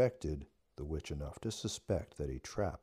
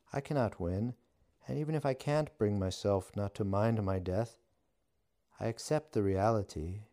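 higher, louder witch voice; 6 semitones higher, 5 dB louder than usual. A narrator's reading. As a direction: play the defiant witch, pitched up. Witch voice held.

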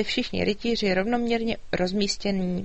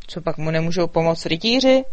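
lazy dragon grumble; 3 semitones lower, 6 dB louder than usual. A narrator's reading. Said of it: "hučel Lukáš".

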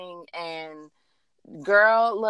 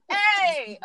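excited; 8 semitones higher, 3 dB louder than usual. Real, not acted. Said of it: "I got carried away".

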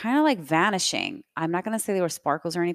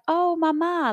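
put-on high, overjoyed little-girl cry; light up like a kid seeing grandma; delighted child; 13 semitones higher, 5 dB louder than usual. Little girl greeting her grandmother with delight.

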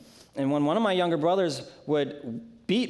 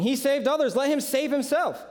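higher, sneering taunt; mild scoffing; 9 semitones higher than usual. A narrator's reading. Leaders at the cross scoffing at Jesus, mild sneer. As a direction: lift it up and jeer at him. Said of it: "the leaders were scoffing".

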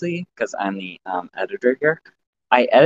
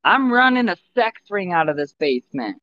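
shouting up to mom; 11 semitones higher than usual. Pretend. Son calling up to his mom from the basement. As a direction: call up to keep her out.

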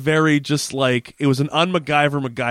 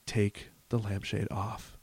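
really quiet; 4 semitones lower, 15 dB quieter than usual. Retold or imitated. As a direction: imitate his careful low murmur. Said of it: "like, really quietly is like".

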